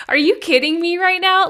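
high, loud teary laughter; laughing through tears. Real, not acted. Host overwhelmed after hearing the light language, teary laughing. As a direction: burst out laughing while near tears. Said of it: "trying not to cry".

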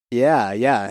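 ordinary delivery

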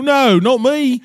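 high, loud mock British protest; British accent. Denying it to the guv, mock British accent. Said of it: "Can you do a British accent?".

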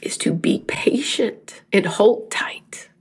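slow, tearful near-whisper; crying. Real, not acted. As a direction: barely get it out through real tears. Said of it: "'cause I'm crying".

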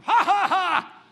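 high, loud, slow ha ha ha; high slow delighted laugh. Acted. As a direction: laugh out God's joke with delight. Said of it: "God's saying".

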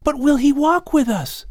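put-on high, pleading child's voice; a boy pressing his grandfather; young boy voice. A narrator's reading. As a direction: push the question like a curious boy. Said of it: "the young boy asks".

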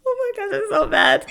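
high, squeaky words through laughter; laughing. Real, not acted. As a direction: giggle helplessly while saying it. Laughing over mom's crying.

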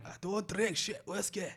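teasing Scottish imitation; Scottish-sounding accent. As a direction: draw it out in a put-on Scottish accent. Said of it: "that sounds more Scottish".

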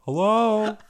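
high, loud mock call of hello. Host calls out hello to his cohost. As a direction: call out to someone far off. Calling out playfully.

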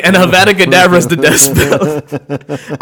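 ordinary talk, nothing marked

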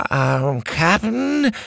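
high, timid little pirate; nervous meek underling; mousy pirate voice. Acted. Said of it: "the mousy pirate".

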